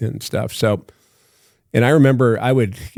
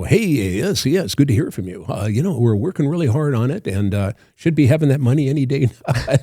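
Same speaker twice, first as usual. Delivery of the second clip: higher, sing-song automatic phone voice; put-on autopilot politeness with swooping pitch; auto voice; 3 semitones higher than usual. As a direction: reel off stock assurances in a lilting auto voice. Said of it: "I developed a voice, like this auto voice".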